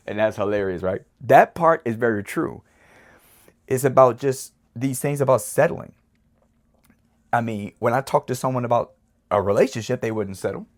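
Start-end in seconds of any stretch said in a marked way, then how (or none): none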